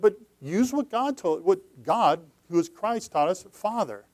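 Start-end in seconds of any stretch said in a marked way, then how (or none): none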